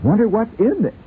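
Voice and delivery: high, eager voice of a curious wife; a wife peering at a giant gift, high and eager; wife's voice. Acted. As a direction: play her as a thrilled, high-pitched wife. Wife voiced in comic sketch.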